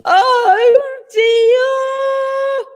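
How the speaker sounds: high, loud sung-out scream. A narrator's reading. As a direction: wail it as she flies off. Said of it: "screamed the polar bear".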